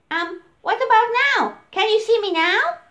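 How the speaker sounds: high, eager elephant voice; breathless little one who finally found him. Acted. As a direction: elephant eagerly greets the crocodile. Elephant steps closer.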